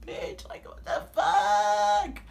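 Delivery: high, drawn-out, slow what the fuck; incredulous disbelief. Retold or imitated. Reacting to the creepy boy's confession. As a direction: stretch it in disbelief. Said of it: "I'm like".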